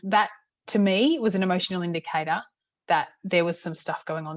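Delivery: normal talking voice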